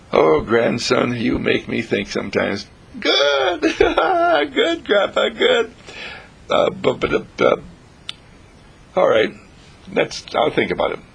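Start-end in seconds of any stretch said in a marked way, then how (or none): 3.0-5.7 delighted cry. Acted. Grandson delighted grandpa softens.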